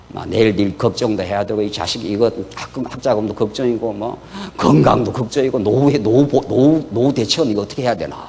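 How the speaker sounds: lightly acted low, fretful listing; worried muttering. Preacher voicing a cluttered head's worries, low fretful muttering. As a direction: run through nagging worries under your breath.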